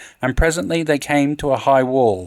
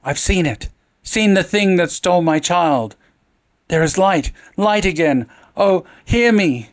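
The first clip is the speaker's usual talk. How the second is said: frantic pleading; 6 semitones higher than usual. A narrator's reading. Madwoman pleading with the Count.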